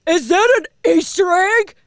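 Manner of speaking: high, loud, drawn-out hammed-up wonder; mock excitement. Podcaster mocking fans hunting clues, exaggerated excitement. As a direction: overplay the thrill of spotting a clue.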